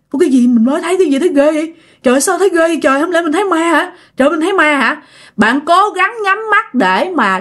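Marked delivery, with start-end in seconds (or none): 0.1-5.0 higher-pitched, panicky self-talk; mildly hammed-up alarm, pitched up; acted fright. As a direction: voice a scared person's racing disbelief. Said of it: "Các bạn sợ quá".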